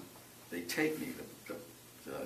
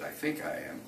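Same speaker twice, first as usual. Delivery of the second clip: laughing answer; 10 semitones higher than usual. Retold or imitated. Laughing reply to ranger.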